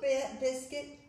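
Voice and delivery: ordinary delivery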